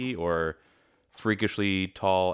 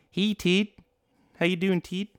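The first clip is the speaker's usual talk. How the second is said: put-on a light take on Tish's accent, much higher; imitating Tish's accent; 12 semitones higher than usual. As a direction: greet her by copying Tish's accent, pitched up. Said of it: "She does it pretty well".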